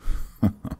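breathy amused chuckle; amused laugh. Acted. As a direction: laugh with condescending delight. Amused at the maid's reaction.

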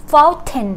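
"fountain" with a fully pronounced t, fown-ten; British clear T. Shown as a demonstration. Teacher naming the British form, clear T in fountain. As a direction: sound the t crisply in "fountain". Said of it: "ก็คือเป็นของอังกฤษ".

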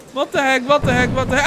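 ordinary delivery

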